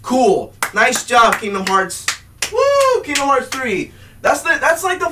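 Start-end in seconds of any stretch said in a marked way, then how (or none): none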